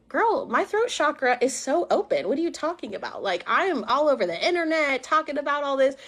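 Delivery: high, swooping sassy retort; incredulous protest. Retold or imitated. Retelling her comeback to the facilitator, incredulous. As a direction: push back with exaggerated disbelief.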